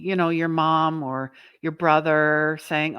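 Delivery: ordinary delivery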